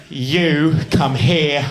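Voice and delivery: slow, plummy English guard; posh English. Retold or imitated. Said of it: "So I'll just make him English".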